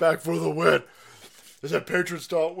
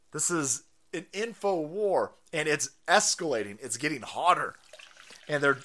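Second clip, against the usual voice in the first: ordinary talk, nothing marked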